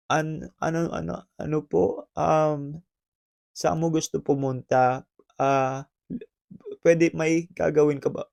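soft, hesitant and unsure; shy guy barely daring to ask; nervous quiet stammer; timid mumble. Shown as a demonstration. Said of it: "nakita mo ba yung diferensya".

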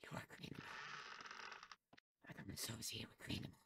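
very soft, voiceless whisper; mock-serious whispering; whispered disdain. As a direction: disown them in a hush.

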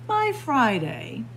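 plain reading voice

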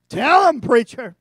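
loud, high hammed-up call; mock congregation shout. Mock churchgoer egging the preacher on, loud high shout. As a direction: holler it like an amen corner. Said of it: "some of you are like".

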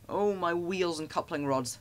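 slow, mildly mournful little engine; sad lament. A narrator's reading. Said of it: "said Thomas sadly".

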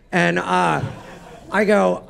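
ordinary talk, nothing marked

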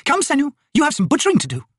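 high, brisk noblewoman's command; imperious lady. Acted. Lady ordering Senu to butcher the kill, imperious. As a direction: summon a servant in a high, grand voice.

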